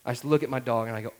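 ordinary delivery

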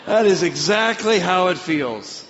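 loud, high, slow exclamation; emphatic realization. Retold or imitated. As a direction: cry it out slowly and emphatically to God. Repeats the realization with full force.

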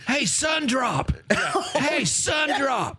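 high, long-drawn holler; mock yelling. Fan taunting from fifty yards away, mock yell. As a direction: stretch it out like a heckler calling across yards. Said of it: "I'm just yelling at this guy".

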